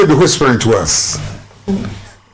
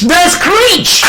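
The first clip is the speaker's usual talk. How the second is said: high, emphatic call of the answer; 12 semitones higher than usual. Real, not acted. Quizmaster announcing the answer, high and emphatic. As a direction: cry it out high, drawing out the key word.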